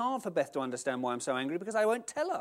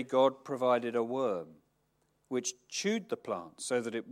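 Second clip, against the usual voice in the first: measured, lowered reading voice; scripture reading; 4 semitones lower than usual. Acted. Preacher reading scripture to the congregation, measured. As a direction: read the passage solemnly. Reads the Jonah passage aloud.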